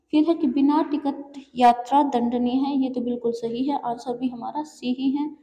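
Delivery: ordinary delivery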